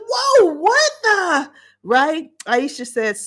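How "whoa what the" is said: high, slow, stunned "Whoa, what the?"; shocked gasp. Retold or imitated. Host recalling seeing Jason punch Drew, shocked. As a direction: caught off guard, voice jumping way up. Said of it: "I said".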